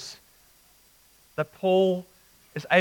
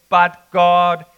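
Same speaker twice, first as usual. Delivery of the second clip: "But God," with a loud punched "But"; emphatic but God; 11 dB louder than usual. Shown as a demonstration. Preacher quoting verse four, loud emphatic stress. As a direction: slam "But" down hard.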